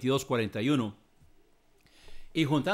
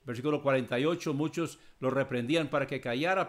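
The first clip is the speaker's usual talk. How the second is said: ordinary talk, nothing marked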